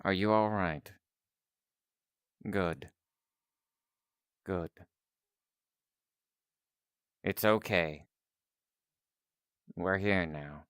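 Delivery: soft, low, comforting check-in; gentle reassurance. Acted. Scientist comforting the one just rescued, soft and reassuring. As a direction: soothe them quietly, leaving space between phrases.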